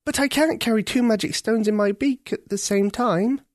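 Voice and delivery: put-on a girl spotting the snag; young girl Ketcha.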